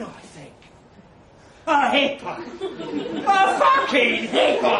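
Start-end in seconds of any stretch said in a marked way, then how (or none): 1.7-4.8 high, very loud disbelieving yell; outraged shout. Acted. Narrator thinking over the hippo claim, outraged. Outraged thought before the fight.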